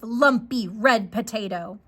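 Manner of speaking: plain reading voice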